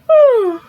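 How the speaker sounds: loud high fake yawn; teacher faking a yawn for the class; pretend yawn. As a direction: stretch out a big pretend yawn. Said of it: "by pretending to yawn".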